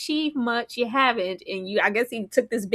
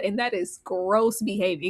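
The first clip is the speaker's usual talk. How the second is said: ordinary talk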